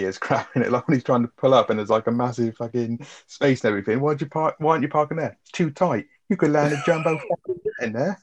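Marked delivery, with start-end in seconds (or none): 4.0-8.2 mock exasperated yell. Retold film quote.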